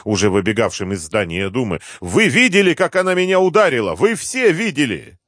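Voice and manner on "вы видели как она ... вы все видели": high, loud outraged appeal; крик. A narrator's reading. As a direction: shout high, calling everyone to witness. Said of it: "закричал депутат, обращаясь к сотрудникам охраны". (высокий крик, призыв в свидетели)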